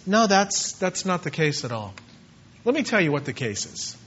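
calm correction, sinking low and soft; Paul, unoffended. Acted. Accused man explaining himself to his judge, calm and unoffended. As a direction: correct him gently, voice dropping. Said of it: "he didn't get offended by it".

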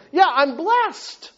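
high, drawn-out mock contentment; easy piety. Preacher mocking his own easy faith, glibly blessed. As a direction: say it slowly, like it costs nothing. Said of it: "That's easy to say".

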